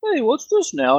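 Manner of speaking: high, nasal-free put-on guard voice.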